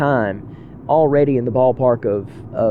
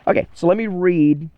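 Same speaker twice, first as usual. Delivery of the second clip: ordinary delivery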